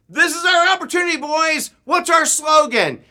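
high, loud rallying call; imitating a leader's urgent yell; scoutmaster shouting. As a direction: shout to rally a troop of boys. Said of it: "I started hearing shouts".

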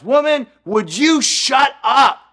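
high, loud bellow at his wife; drunk husband's yell. Retold or imitated. Drunk husband yelling at his wife during a traffic stop. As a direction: roar it like a fed-up drunk husband. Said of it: "the man yells".

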